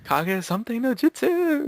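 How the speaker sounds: high, slow, hammed-up ninja chant; mock anime attack call. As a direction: call it out like a cartoon ninja move.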